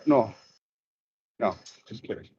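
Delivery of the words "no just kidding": muttered aside. Real, not acted. Drops voice after joke.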